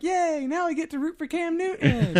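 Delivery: high, gleeful mock cheer; imitated little sister. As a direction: cheer it like a delighted little girl. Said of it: "is his sister".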